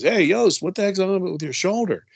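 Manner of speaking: a locker room buddy yelling over; calling out across the shower. Retold or imitated. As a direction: shout the question at a teammate.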